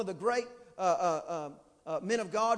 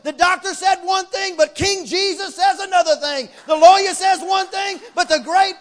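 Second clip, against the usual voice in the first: bold shouted praise; 6 semitones higher, 12 dB louder than usual. Retold or imitated. A believer entering God's courts, shouting bold praise. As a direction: shout your praise to the throne. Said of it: "You walk in and say".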